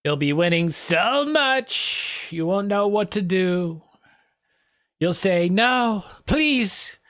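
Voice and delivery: high, drawn-out campaign boasting; mock winning-rally speaker. Parodying a president's winning speech, mock boastful. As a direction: stretch the words in a high mock-pleading lilt.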